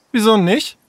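high, surprised comeback. Acted. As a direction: question it, taken aback. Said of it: "Sarah guckte überrascht". (überrascht, mit hoher Stimme)

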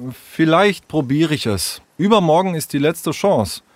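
tentative, lightly voiced child; undecided. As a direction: weigh it up, unsure of yourself. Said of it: "Henry wirkte unentschieden".

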